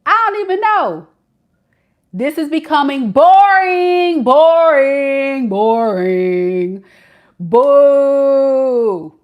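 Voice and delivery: high, loud bored complaint; voicing the man. Reader voicing the bored boyfriend complaining to Shay, high and loud. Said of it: "He like".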